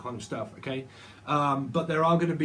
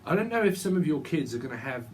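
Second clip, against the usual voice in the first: ordinary talk, nothing marked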